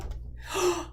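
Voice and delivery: short gasp of surprise. Acted. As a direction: suck in breath at a discovery. Douglas peeps into the cave.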